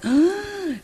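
high, startled gasp of realization; sharp gasp. Retold or imitated. Recalling the moment she realized, a sharp gasp. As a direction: gasp as it suddenly dawns on you.

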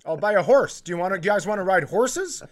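high, loud, desperate pleading; mock meltdown bribing son with anything; frantic bribing. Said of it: "just having a mental breakdown".

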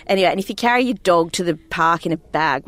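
ordinary talk, nothing marked

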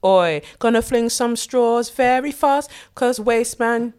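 hyped-up cussing. Acted. Playful cussing bit, loud.

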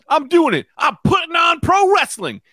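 high, loud mock proclamation; defiant shout. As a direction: shout it to the room as the stubborn old man. Man announces he's changing the TV.

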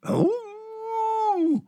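a high, delighted "ooh"; tiny excited squeak; high squeal. Acted. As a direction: squeal up high like a little lamb. Character exclaims in story.

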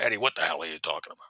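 low, quick imitated captain; George Duke. Captain answering Eddie's accusation, low imitation. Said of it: "a very distinct way of talking".

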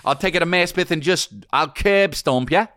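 high, slow, hammed-up New York tough guy with Australian vowels; Anthony LaPaglia. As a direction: threaten in a bad Australian-New York accent. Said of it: "I'm from New York".

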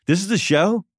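high, mock-stunned question; incredulous. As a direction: sound like you can't believe this is it. J T doubting the tiny show.

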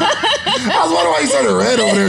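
talking through laughter; laughing. Real, not acted. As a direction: giggle through the words. Laughing at the coughing friend.

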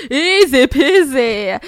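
easy peasy said slowly through laughter; stretched-out, giggly easy peasy; laughing easy peasy. Retold or imitated. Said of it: "But also".

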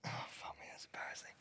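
breathy whispered groan of embarrassment; embarrassed whisper. Real, not acted. Host whispers to his co-host after his name is left out, embarrassed whisper. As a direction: whisper it as if wanting to hide. Reacting to embarrassing press news.

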